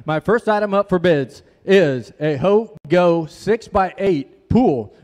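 normal talking voice

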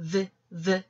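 V as a short voiced 브 with lip bitten; isolated v sound for V, not the letter name; letter sound demo. Shown as a demonstration. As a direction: sound out V twice, short and separate. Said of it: "발음은".

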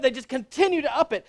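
usual speaking voice

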